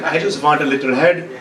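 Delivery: put-on accented English, plainly insistent; immigrant dad's accent. Dad explaining his order to the drive-thru girl, accented English. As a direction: imitate dad repeating himself in accented English. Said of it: "dad who doesn't speak very good English".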